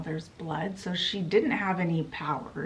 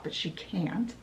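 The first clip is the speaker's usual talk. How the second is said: ordinary delivery, nothing marked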